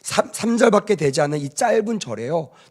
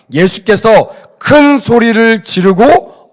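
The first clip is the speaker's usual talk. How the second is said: very slow, loud scripture reading; 5 semitones higher, 10 dB louder, much slower than usual. Shown as a demonstration. Preacher leading the church in reading the verse, slow and loud. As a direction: stretch every word, loud and high. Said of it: "시작".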